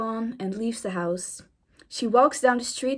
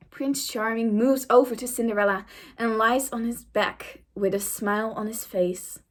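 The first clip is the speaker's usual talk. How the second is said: usual speaking voice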